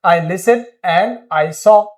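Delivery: every word slow and separate; slow dictation. Shown as a demonstration. Teacher translating a Hindi sentence for students, slowly. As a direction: lay each word down slowly. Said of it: "मैंने सुना और मैंने देखा।".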